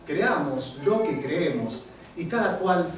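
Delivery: emphatic spoken-word poetry with swinging pitch; poem recitation. Acted. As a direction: declaim the rhymes, landing on each wordplay. Recites own wordplay poem.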